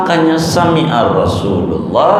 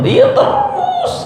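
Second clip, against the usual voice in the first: very high, slow squeak; squeaky mock reply; slower than usual. Preacher mocking the endless nagging, a high slow squeak. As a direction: squeak it out as a teasing punchline. God's answer, nagging continues.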